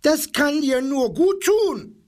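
very high, shrill-voiced parrot cry; screeching parrot. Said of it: "kreischte der Papagei". (der Papagei kreischt die Giraffe spöttisch an, sehr hoch)

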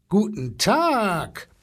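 slow, drawn-out elephant greeting, swooping up high; blustering elephant. A narrator's reading. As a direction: boom a long hello like an elephant. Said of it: "polterte der Elefant".

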